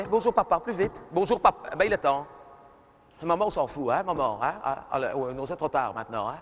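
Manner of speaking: ordinary delivery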